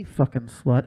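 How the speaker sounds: low, spat-out aside; bitter jab under her breath; muttered insult. Pretend. Mom cursing the girlfriend aside, low and harsh.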